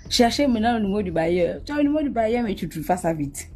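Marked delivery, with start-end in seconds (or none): none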